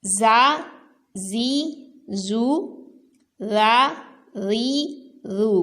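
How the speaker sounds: زا زی زو then را ری رو, clipped and clean; each syllable crisp with short vowels; teacher drilling letters with vowels. Shown as a demonstration. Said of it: "زا کو نرمی سے نہیں پڑھنا".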